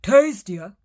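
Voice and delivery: loud eager troll questions; greedy cry. Acted. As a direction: cry out hungrily. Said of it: "he cried".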